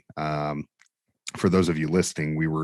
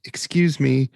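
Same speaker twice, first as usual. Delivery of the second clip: lightly voiced timid lady, higher and louder; hint of the shy lady squeezing past; imitating the meek Police Academy lady asking to get by; meek polite lady; 8 semitones higher, 4 dB louder, slower than usual. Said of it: "the real quiet lady from".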